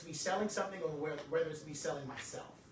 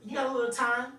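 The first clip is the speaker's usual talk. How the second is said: pushy salesman; 7 semitones higher, 5 dB louder than usual. Retold or imitated. Mocking a pushy salesman pitch.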